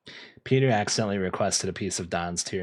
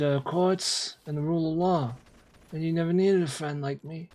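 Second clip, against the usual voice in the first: Godfather impression; 6 semitones higher than usual. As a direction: play the don granting a favor. Godfather monologue performed unprompted.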